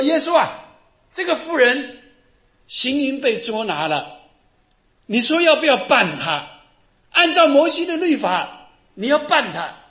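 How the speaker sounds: high, loud demand for judgment; accusing crowd. Acted. Acting out accusers before Jesus.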